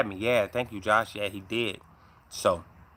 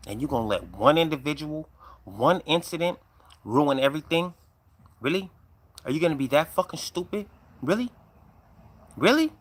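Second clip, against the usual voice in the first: higher, incredulous telling-off of himself; angry self-scolding; 5 semitones higher, 5 dB louder than usual. Retold or imitated. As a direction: give yourself a heated talking-to, building to a high, loud "really". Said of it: "I'm so angry".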